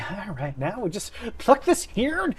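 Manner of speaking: lightly voiced Southern wizard, rising at the end; southern accent. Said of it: "gave him a southern accent".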